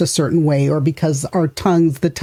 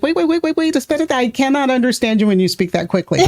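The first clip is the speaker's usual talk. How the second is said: flustered pleading; 9 semitones higher, faster than usual. Retold or imitated. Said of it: "I'll be like".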